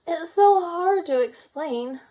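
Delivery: tearful and slow, struggling to get it out; sniffling. A narrator's reading. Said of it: "Laurel sniffled".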